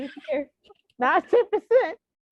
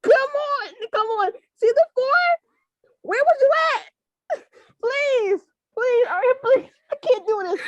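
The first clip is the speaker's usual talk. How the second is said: higher, louder pleading, voice breaking into a cry; tearful begging; 7 semitones higher, 6 dB louder than usual. Real, not acted. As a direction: beg through real tears, half laughing. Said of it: "now that we're all fucking crying".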